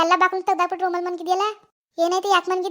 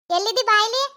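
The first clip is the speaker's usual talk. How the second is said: louder, higher summons; calling out; 3 semitones higher, 4 dB louder than usual. Acted. Calling grandmother over to the crying child, calling out. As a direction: call out for grandmother, raised voice.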